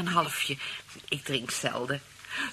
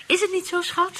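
coaxing prompt; 13 semitones higher, 7 dB louder than usual. Acted. Wife prompts husband to volunteer.

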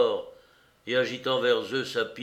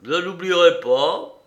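loud, higher, ambiguous promise; peculiar intonation; 6 semitones higher, 9 dB louder than usual. A narrator's reading. Silver answering Jim's plea, loud and enigmatic. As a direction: say it so nobody can read your meaning. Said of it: "avec une intonation si particulière".

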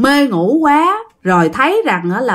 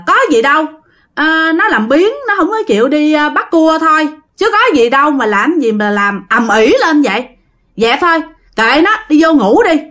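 higher-pitched irritated dismissal; scolding; 5 semitones higher than usual. Acted. Grandmother scolding her worried daughter-in-law, irritated and dismissive. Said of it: "bà nội còn chửi mẹ nữa".

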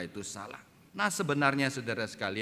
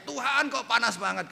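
high, loud protest to God; acted-out shout of grievance; mock yelled complaint; 10 semitones higher, 7 dB louder than usual. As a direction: cry out in hammed-up exasperation. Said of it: "kita teriak".

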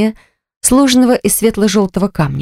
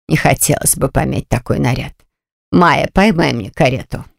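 cold aunt voice; 5 semitones lower than usual. Acted.

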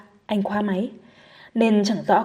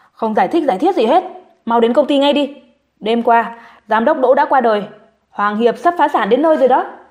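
loud, raised-pitch urgent demand; frantic colleague shouting bad news down the line; yelling on the phone; 3 semitones higher, 7 dB louder than usual. A narrator's reading. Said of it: "đối phương đã thét lên". (thét lên hối thúc qua điện thoại)

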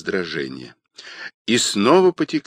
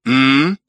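loud, raised "mmm"; drawn-out hum rising in pitch; reluctant hum; 5 semitones higher, 6 dB louder than usual. A narrator's reading. Rodionov realises he is caught, reluctant humming. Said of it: "мычал Родионов".

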